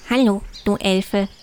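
leicht verstellte Hasenstimme, tiefer als die Elfe. A narrator's reading. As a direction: ein Hauch vom freundlichen Hasen. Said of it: "antwortete er". (der Hase grüßt die Elfe zurück, kaum verstellt)

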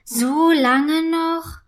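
long-drawn whiny complaint; slow, impatient moan; grumbling. A narrator's reading. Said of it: "Murrend geht sie".

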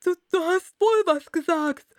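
high, loud stammered retort from the Hatter; rufend. A narrator's reading. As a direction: call out the accusation, tripping at the start. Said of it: "rief der Hutmacher". (laut und hoch zurückrufend)

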